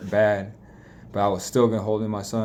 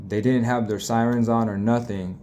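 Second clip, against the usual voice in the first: ordinary talk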